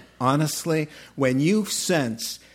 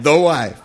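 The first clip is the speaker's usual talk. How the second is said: THE leaned on as a joking correction; deliberately emphasize THE before wife; stress on THE; 9 dB louder than usual. Shown as a demonstration. Preacher joking about his pronoun slip, stress on THE. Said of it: "I just dropped a little pronoun".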